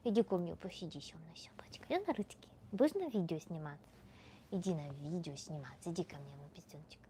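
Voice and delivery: very soft, half-whispered Russian coaxing; cooing to a pet. Real, not acted. Inviting the doggie onto the video, tender cooing. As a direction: coo gently to a little dog, almost whispering.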